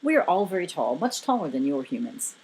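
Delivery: low, measured giant's voice; Morgan Freeman-like. A narrator's reading. Giant explaining his height to a visitor, deep and measured. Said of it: "still sounding all Morgan Freeman-like".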